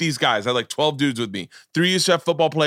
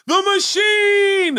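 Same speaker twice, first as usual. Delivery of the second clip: long drawn-out high shout of the name; shouted nickname; 4 dB louder, much slower than usual. Retold or imitated. Partygoer hailing Bert at the door, drawn-out shout. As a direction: bellow it like a party crowd greeting a legend.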